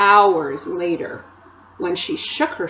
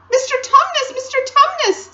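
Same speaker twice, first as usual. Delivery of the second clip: put-on very high, loud distressed girl; great distress; 5 dB louder than usual. A narrator's reading. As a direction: call out to him in anguish. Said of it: "said Lucy in great distress".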